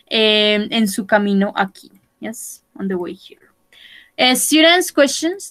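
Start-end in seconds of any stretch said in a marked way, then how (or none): 2.2-3.5 soft whispered repeat. Shown as a demonstration. Teacher whispering the phrase to students. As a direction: whisper it back to the class.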